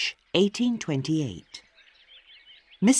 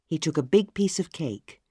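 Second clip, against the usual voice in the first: ordinary delivery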